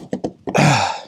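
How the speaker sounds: tired exasperated sigh; irritated huff. Real, not acted. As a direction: huff out a breath of effort. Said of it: "Sorry about all the huffing and puffing".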